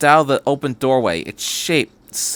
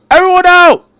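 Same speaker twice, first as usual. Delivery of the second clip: much higher, much louder yell; shout; 14 dB louder than usual. A narrator's reading. Doctor yells to everyone to flee the train. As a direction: drive everyone out the door. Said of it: "he shouted".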